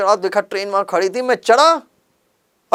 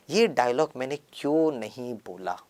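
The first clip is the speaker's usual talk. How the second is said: ordinary talk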